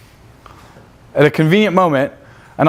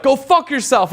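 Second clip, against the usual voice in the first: imitated higher-pitched comeback; mock angry retort; 8 semitones higher than usual. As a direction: play the offended guy telling someone off. Guy's retort in worst case story.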